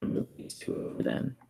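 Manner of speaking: whisper. Real, not acted. Muttering to self while editing.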